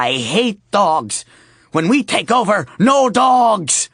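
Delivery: high, loud ranting harpy shout; angry harpy. Acted. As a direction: rant about dogs, building to a shout.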